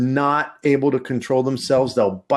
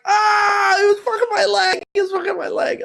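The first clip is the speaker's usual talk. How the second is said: mock crying kid. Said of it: "he was like".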